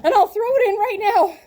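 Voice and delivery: high, urgent demand; freaking out. Retold or imitated. Urgently asking the scheduler for dates, frantic. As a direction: rattle it off in a frantic rush. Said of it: "So I'm freaking out".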